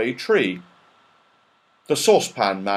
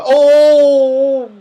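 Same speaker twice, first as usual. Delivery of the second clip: groaning; 14 semitones higher, 10 dB louder than usual. Acted. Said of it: "groaned the children".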